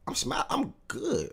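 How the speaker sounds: squeaky voice from excitement; voice cracking. Real, not acted. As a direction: voice squeaks up and breaks. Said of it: "I can't even talk right 'cause my voice".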